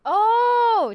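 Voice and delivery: a loud, high delighted oh; excited oh. Real, not acted. Streamer seeing a new emote, loud delighted oh. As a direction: burst out with delight.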